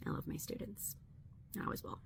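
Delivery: tender whisper; whispered love for her students. Real, not acted. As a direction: whisper it, holding back tears. Laid-off teacher's closing words.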